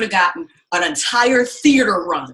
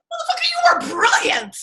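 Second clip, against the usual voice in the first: much higher, loud gushing praise; high emphatic praise; 4 dB louder than usual. Retold or imitated. To Angela after the play, high gushing praise. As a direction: burst out praising her brilliance.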